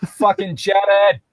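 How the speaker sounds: mock shout. Real, not acted.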